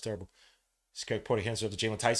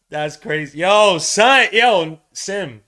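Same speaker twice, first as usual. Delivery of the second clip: excited shout; 6 semitones higher, 13 dB louder, much slower than usual. Real, not acted. Shouting to his friend in disbelief.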